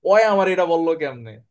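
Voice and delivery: lightly acted angry retort; acted indignation. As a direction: play someone briefly taking offence. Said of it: "রাগান্বিত হয়ে যায়".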